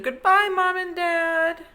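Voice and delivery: high-pitched little Jerry voice; high little jellyfish voice. Acted. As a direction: sing it out like a small excited kid. Child jellyfish says goodbye.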